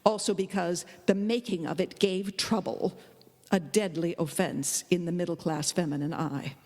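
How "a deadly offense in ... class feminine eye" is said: usual speaking voice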